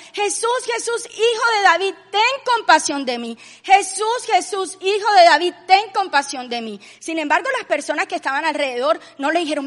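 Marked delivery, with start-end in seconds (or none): 0.1-6.8 imitating a blind beggar's pleading cry; retold desperate cry. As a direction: call out over a crowd, pleading. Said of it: "empezó a gritar y le decía".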